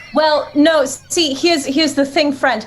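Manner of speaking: high, polite introduction; courteous hero pitched high; polite adventurer. Acted. Player pleads as Lilia with dragon.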